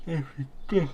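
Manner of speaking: words stretched by a yawn. Real, not acted. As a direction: say it mid-yawn. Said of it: "I'm so yawny today".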